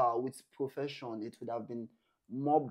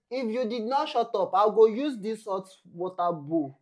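mock threat; 7 semitones higher, 9 dB louder than usual. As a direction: play the angry man warning him to shut up. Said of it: "The man said".

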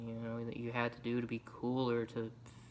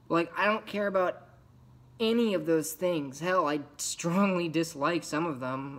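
louder, higher, emphatic venting; frustrated outburst; 4 semitones higher, 5 dB louder than usual. Acted. Outcast rejecting what peers do for fun, frustrated outburst. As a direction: burst out with pent-up dislike.

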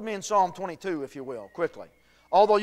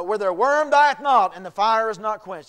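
emphatic scripture reading; 3 semitones higher, 8 dB louder than usual. Acted. Same verse repeated, emphatic.

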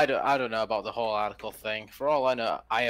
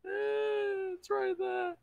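squeaky high voice; much slower than usual. Real, not acted. Pointing excitedly at the screen.

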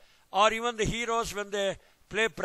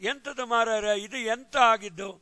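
Mangalore Kannada dialect; much faster than usual. Shown as a demonstration.

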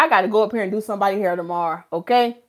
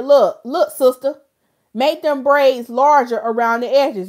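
louder, bossy demand; with bass in the voice; 4 semitones higher, 5 dB louder, slower than usual. Shown as a demonstration. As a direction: order the braider around. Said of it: "don't have no bass in your voice".

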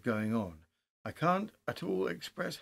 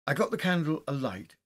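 plain reading voice

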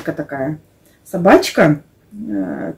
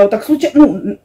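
ordinary delivery

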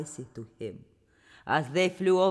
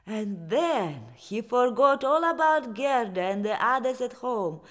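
usual speaking voice

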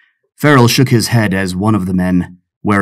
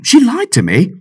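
whiny protest; 13 semitones higher than usual. Acted. Pirate James excusing himself about the admiral's wife, whiny protest. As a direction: voice jumping high in protest.

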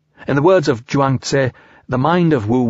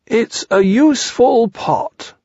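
higher, slow proud bear voice; lightly voiced Pooh; 11 semitones higher, slower than usual. A narrator's reading. Pooh presenting his pot to Eeyore, proud bear voice. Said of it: "said Pooh".

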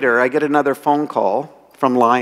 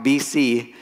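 ordinary delivery

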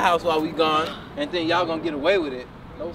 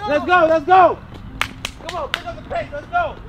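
high-pitched urging holler; insistent coach's call; calling after runners; 10 semitones higher than usual. Acted. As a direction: chivvy them along from behind. Calling after running kids.

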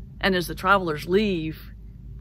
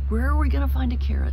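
loud musing; 8 semitones higher, 5 dB louder, faster than usual. Acted. Travelers baiting villagers loudly.

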